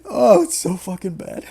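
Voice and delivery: words breaking out of a laughing fit; amused despair through laughter. Real, not acted. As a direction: say it while still cracking up.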